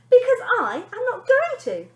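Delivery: very high, loud snap; nanny snapping at the girl; snapped. Acted. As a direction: snap it back sharply. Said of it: "snapped the lady".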